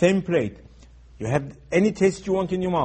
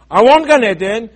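loud plea; 6 semitones higher, 10 dB louder than usual. Retold or imitated. Telling God what you want.

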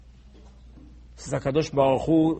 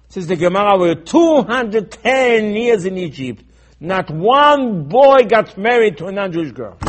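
loud, high, hammering sermon delivery; impassioned raised-voice preaching; full-force preaching; 5 semitones higher, 10 dB louder than usual. Real, not acted. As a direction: thunder it at the congregation, pounding each point.

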